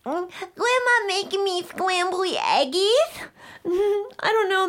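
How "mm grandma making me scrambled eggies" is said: put-on slow, lower little-kid voice; toddler voice. Toddler asking big sister about grandma's eggs. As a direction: talk like a sleepy toddler.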